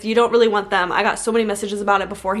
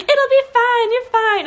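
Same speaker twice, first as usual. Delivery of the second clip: high, louder sing-song reassurance; high mock nurse voice; 4 dB louder, slower than usual. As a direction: parody fake soothing from staff, high. Mimicking staff reassuring her.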